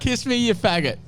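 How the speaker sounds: hammed-up slurred taunt; mock drunk taunt. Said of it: "Always a good one".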